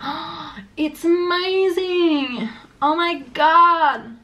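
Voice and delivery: gushing, drawn-out, high-pitched English enthusiasm; over-the-top amazed English intonation; exaggerated English zigzag. Shown as a demonstration. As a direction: stretch it out loud with swooping pitch. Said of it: "genre tu vois, ils vont dire".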